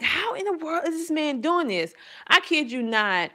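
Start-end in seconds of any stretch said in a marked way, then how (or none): 0.0-1.9 high-pitched retold astonishment; amazed disbelief. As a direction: voice your bafflement, pitched well up. Retelling her amazement at the teacher.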